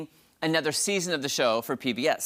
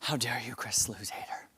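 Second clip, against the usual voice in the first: mock outrage whisper; 5 semitones lower, 8 dB quieter than usual. Mock outrage at bored friend.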